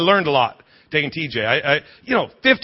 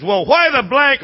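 imitated yell, pitched up; screaming fan; 7 semitones higher, 4 dB louder than usual. Man behind hollering at his wife, screaming. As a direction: mock-scream it like an irate fan. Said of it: "I hear the guy behind me screaming".

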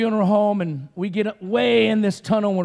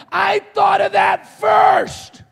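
high, slow, loud cry of protest; indignant mock yell; 11 semitones higher, 7 dB louder, much slower than usual. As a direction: yell it like a robbed inventor. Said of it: "How many of you have been like".

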